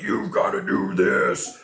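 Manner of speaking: low, slow, gruff demand; pushy drill coach. Retold or imitated. Mimicking a pushy coach who yells, low and slow. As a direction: bark it like a drill sergeant.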